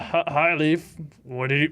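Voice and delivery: ordinary talk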